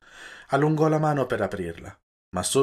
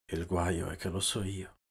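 softer grumbling mutter; 3 semitones lower, 9 dB quieter than usual. A narrator's reading. Gibetti falling back on the bed, muttering softly. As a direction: mutter it to yourself, defeated. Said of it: "borbottò il Gibetti".

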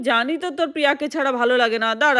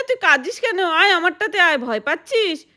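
ordinary delivery

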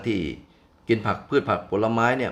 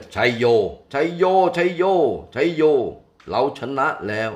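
loud, high cheering cry of triumph; joyful victory shout; 6 semitones higher, 5 dB louder than usual. Acted. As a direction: shout chaiyo with all your joy. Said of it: "ส่งเสียงร้องลั่นขึ้นด้วยความดีใจ". (พญาช้างโห่ร้องชัยโยด้วยความดีใจ)

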